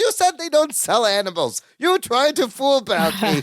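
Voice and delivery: high comic character voice; Balki. Retold or imitated. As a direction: imitate him high, ending on a laugh. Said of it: "He goes".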